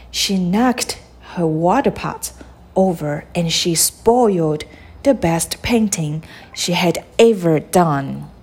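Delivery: usual speaking voice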